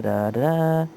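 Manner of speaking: normal talking voice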